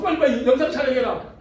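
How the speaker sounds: high-pitched, hammed-up excitement; excited urging. Pastor wants the talking pet filmed at once, excited urging. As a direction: urge them on in mock frantic delight.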